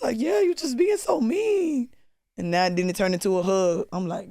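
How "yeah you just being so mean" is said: mock crying. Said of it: "I started crying".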